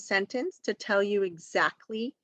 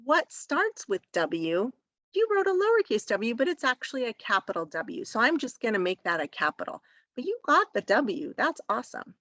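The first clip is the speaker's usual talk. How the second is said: high-pitched, delighted teacher praise; acted encouragement for a young writer; gentle encouragement; 4 semitones higher than usual. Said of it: "you wanna really encourage all the great".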